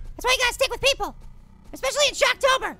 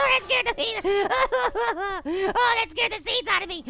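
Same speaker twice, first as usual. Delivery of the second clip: words broken by helpless laughing; scared laughter. Real, not acted. Telling viewers the scare got him, laughing in relief. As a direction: laugh hard through the words, settling as it goes.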